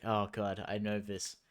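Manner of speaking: ordinary delivery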